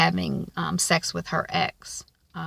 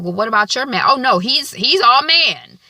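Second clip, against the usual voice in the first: defensive friend; 6 semitones higher, 8 dB louder than usual. Retold or imitated. Friend insists her own man is all man, mock defensive. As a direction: swear he's all man.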